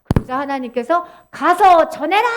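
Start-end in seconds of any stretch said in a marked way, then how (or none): 1.3-2.3 loud, drawn-out divine order; commanding. Acted. God ordering Ezekiel to go, loud command. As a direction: send them off with a big command.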